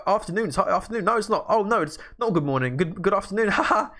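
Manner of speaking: flustered correction. Retold or imitated. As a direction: scramble to fix the greeting, flustered. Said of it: "I was like".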